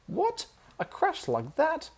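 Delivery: very high disbelieving outburst; high shocked aunt; incredulous cry. Acted. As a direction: she can't believe the noise. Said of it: "cried his aunt".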